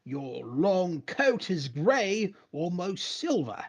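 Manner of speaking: high, slow, drawn-out storyteller; grand game narration. Acted. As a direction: proclaim the description phrase by phrase. Reads game text in grand narrator style.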